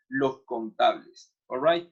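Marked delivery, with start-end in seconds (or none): none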